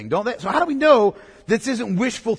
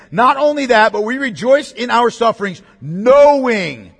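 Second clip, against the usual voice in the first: "knowing" set apart and pushed out louder; stress on knowing; 4 dB louder than usual. Shown as a demonstration. Preacher points congregation to the key word, stress on "knowing". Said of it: "Underline that word knowing".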